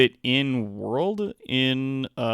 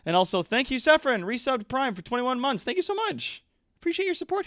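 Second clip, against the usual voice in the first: much higher, cutesy thank-you voice; squeaky thanks; 13 semitones higher than usual. Real, not acted. Thanking a resubscriber.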